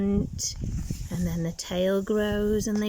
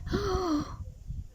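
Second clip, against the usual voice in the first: high, soft frightened gasp; 9 semitones higher, 4 dB quieter than usual. Acted. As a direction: gasp as the fear sinks in. Tad fearing Big Blub.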